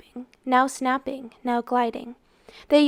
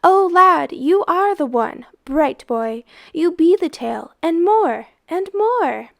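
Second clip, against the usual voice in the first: high, loud urging; dark man cheering the boy on, elated; excited cheering; 6 semitones higher, 7 dB louder than usual. Acted.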